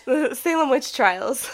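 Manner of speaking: slow words through laughter; laughing. Real, not acted. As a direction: say it while laughing.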